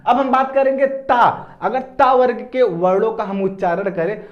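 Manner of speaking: normal talking voice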